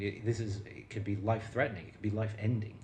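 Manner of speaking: ordinary talk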